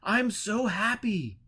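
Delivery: high, slow, overjoyed cry of thanks; joyful pilgrim. A narrator's reading. Pilgrim greeting the holy man with slow joyful cry. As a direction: pour out joy to the holy man.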